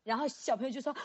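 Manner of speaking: ordinary talk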